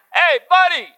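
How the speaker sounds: high call into the jungle; calling out, dad voice. Retold or imitated. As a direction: hail your son from afar. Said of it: "this preaching voice, it comes from calling out".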